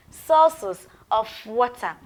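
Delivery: "Sources of water" spoken slowly and clearly; slow model phrase. Shown as a demonstration. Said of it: "Say it after me".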